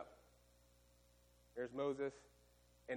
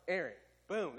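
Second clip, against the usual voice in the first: normal talking voice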